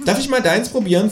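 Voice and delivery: high kangaroo voice; Känguru voice. Acted. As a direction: ask it high and cheeky. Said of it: "fragt es".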